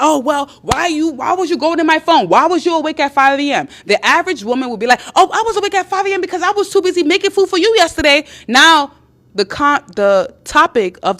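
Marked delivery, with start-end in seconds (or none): 0.0-3.7 higher-pitched, defensive counter-questions; accusing deflection. Acted. Man caught texting deflects at his girlfriend, accusing. 5.1-8.2 fast, high-pitched rant in one breath; mock flustered, rapid self-justification pitched way up; defensive retort. Average woman answers her man's accusation, defensive.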